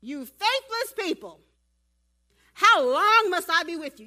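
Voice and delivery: loud, high, drawn-out acted rebuke; hammed-up exasperated shout; exasperated Jesus. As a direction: hurl it at the crowd, stretching the words. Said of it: "you ready for this?".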